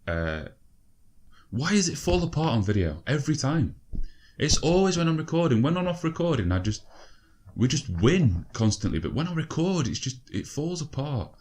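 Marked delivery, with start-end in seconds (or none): 1.5-11.3 much higher, whiny exasperation; high-pitched frustrated moaning; exasperated rant. Real, not acted. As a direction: complain to the camera, fed up. Frustrated rant about recording.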